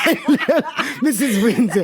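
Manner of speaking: a hint of a sweet old lady; old person voice. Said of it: "you talk to them like this".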